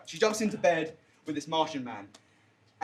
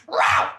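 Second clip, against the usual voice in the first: short, loud creature roar; mock monster bellow; imitated alien roar; 6 dB louder than usual. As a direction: roar like a crazy Martian. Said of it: "they're like this, they're like".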